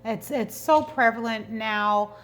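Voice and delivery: usual speaking voice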